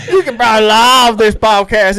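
LIVE said as "lahv", teasing; mock mispronunciation. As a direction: copy the slip, LIVE becoming "lahv". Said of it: "because they can't pronounce it".

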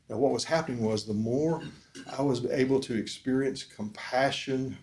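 ordinary delivery

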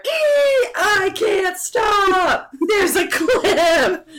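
slow high panicked yell; panicked scream. Retold or imitated. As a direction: shriek in terror at the crash. Panic as brakes fail.

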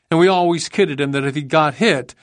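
ordinary delivery

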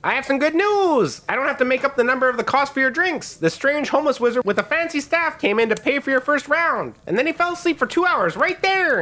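excited, very high gnome chatter; Gilly. Acted. Gnome innkeeper tells guests of paid drinks, in a high excited voice. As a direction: share good news in a high gnome squeal. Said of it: "Gilly continues".

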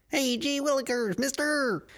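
put-on high, chirpy old-timey child; gee-whiz innocent little kid; wholesome 1950s kid. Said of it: "revert to old-timey talk like".

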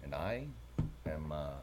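deadpan, hesitant professional gravity; mock-clinical. Co-host introduces himself as medical professional, mock-clinical. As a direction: pretend to be solemnly clinical, low and quiet. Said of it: "you're so serious".

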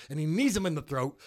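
usual speaking voice

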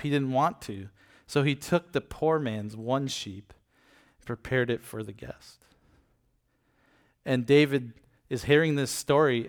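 usual speaking voice

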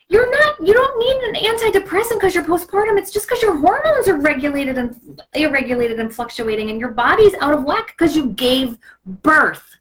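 high, heated rant; indignant outrage for postpartum moms; on the soapbox. Real, not acted. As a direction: passionate soapbox tirade. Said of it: "Sorry, I'm on the soapbox".

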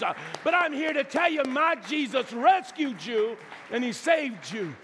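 high, loud, driving sermon voice; full-force preaching. Real, not acted. Preacher urging the congregation that Jesus rescued them, full-force preaching. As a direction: preach it hard, drawing out the big phrases.

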